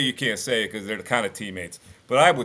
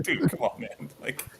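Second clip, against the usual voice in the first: laughing disbelief; 6 semitones higher, 4 dB quieter than usual. Retold or imitated. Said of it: "I'm like".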